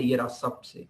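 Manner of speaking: ordinary talk, nothing marked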